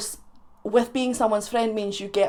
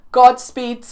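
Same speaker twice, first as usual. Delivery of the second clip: mock farewell; 3 semitones higher, 11 dB louder than usual. Send-off to toilet viewers.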